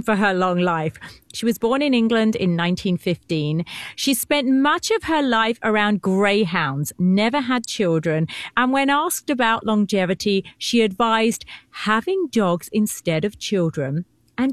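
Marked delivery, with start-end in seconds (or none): none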